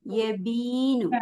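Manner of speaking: യബീനു with a long-held ബീ; lengthened vowel. Shown as a demonstration. Teacher shows pupils how to read it, lengthened vowel. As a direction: stretch ബീ slowly in യബീനു. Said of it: "പിന്നെ ബീനെ നീട്ടാന്".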